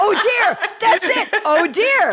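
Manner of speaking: high, laughing mock "Oh, dear"; laughing echo. Host echoing Nancy's reaction, high and laughing.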